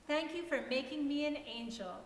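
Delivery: ordinary delivery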